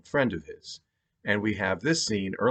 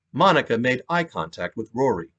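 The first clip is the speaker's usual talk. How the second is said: ordinary delivery, nothing marked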